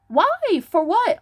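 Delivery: high-pitched indignant comeback; indignant challenge. Real, not acted. Answering those who make fun, indignant.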